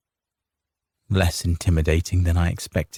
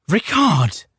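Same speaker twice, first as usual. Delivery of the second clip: high, sharp scolding call; 10 semitones higher than usual. A narrator's reading. Wife scolding her husband mid-standoff, reproachful. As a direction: rebuke him for his tongue. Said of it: "Carita scolded".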